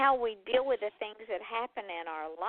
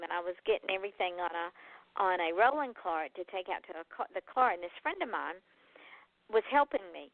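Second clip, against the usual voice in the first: normal talking voice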